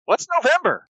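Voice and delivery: high, sweet toy-animal voice; childlike plush friend. Acted. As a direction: speak like an eager stuffed elephant bear. GM voices Billy Bucks.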